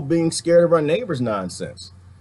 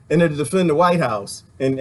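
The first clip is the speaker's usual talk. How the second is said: ordinary talk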